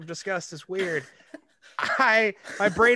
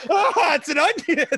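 laughing quote; 10 semitones higher, 5 dB louder than usual. Acted. Acted joke through laughter.

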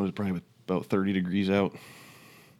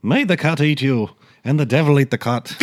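put-on loud, high-pitched amateur Irish accent; playful, worked-up Irish brogue attempt; 6 semitones higher, 6 dB louder than usual. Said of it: "try to say that in an Irish accent".

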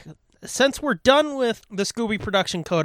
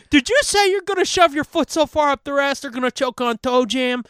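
imitating mom; 6 semitones higher than usual. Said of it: "She's like".

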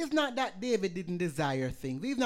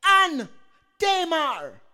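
high, loud call across a distance; calling out names; 10 semitones higher, 15 dB louder, much slower than usual. Pretend. The world calling believers by name, loud high call. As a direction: cry the names out as the world summoning you.

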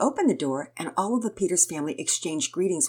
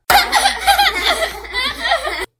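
loud, high, long laugh; big acted peal of laughter; whole-family laughter; 10 dB louder than usual. A narrator's reading. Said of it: "The whole family laughed".

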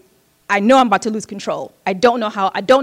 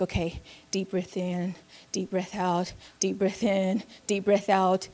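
soft self-calming breaths; 3 semitones lower, 12 dB quieter, slower than usual. Retold or imitated. Calming herself on the bed.

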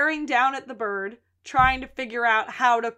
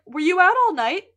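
startled; 6 semitones higher, 7 dB louder than usual. Retold or imitated. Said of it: "She's like".

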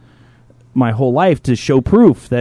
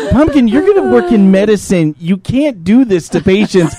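mock scolding; 8 semitones higher, 4 dB louder than usual. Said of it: "And literally, like".

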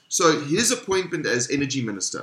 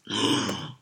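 soft theatrical gasp; mock gasp; 6 semitones lower, 8 dB quieter than usual. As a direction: fake shock gasp. Shock at revelation.

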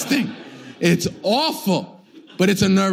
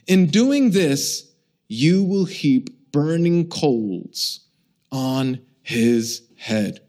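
slow emphatic scripture; much slower than usual. Acted. Slow dramatic scripture reading.

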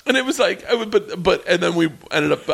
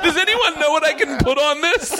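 mock clueless Roy; 13 semitones higher than usual. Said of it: "this is the first post".

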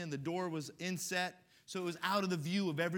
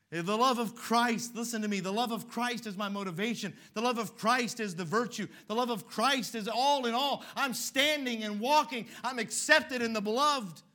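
building preaching; 5 semitones higher, 6 dB louder than usual. Acted. Preacher builds to a loud declaration of God's love. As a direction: build it up with conviction.

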